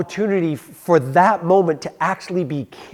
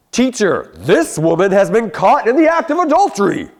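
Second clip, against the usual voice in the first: a hint of an accusing Pharisee, a bit higher and louder; lightly voiced self-righteous accuser; 6 semitones higher, 6 dB louder than usual. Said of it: "That's my Pharisee voice".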